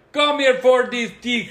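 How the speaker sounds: high, loud mock Jamaican accent; hammy put-on Jamaican voice. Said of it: "doing a great Jamaican accent".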